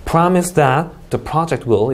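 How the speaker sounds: "Promise that the project will" run on without a break; slow, connected demo of "Promise that the project will"; linked reading. Shown as a demonstration. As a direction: read "Promise that the project will" as one group. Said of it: "사실 끊어 읽기도 중요하지 않네요".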